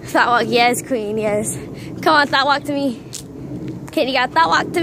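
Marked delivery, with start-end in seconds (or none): none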